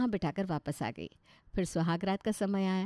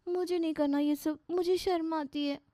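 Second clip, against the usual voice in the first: put-on shy young bride; 8 semitones higher than usual. Shy bride refuses husband.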